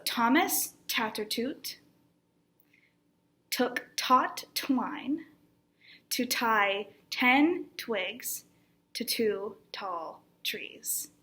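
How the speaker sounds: slow, separated words with crisp t's. Shown as a demonstration. Teacher breaking down a tongue twister, very slowly. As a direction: take it word by word, pausing between phrases. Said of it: "take this one very slowly".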